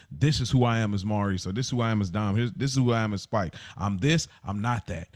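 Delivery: ordinary talk, nothing marked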